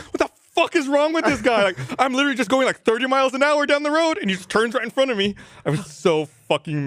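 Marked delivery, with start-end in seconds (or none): 0.0-5.3 high, agitated mock rant; retold angry screaming. Driver screaming about whoever cut him off, high retold rant. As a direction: re-enact a furious outburst without fully screaming. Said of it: "I just started screaming 'cause I was so mad".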